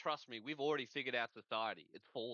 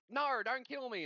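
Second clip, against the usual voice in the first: high, whiny "don't kill me"; mock pleading; 10 semitones higher, 3 dB louder than usual. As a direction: beg for your life, hammed-up. Begging survivors in apocalypse.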